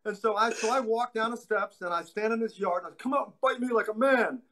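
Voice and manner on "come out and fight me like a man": put-on eight-year-old [name]. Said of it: "said the eight, eight-year-old [name]".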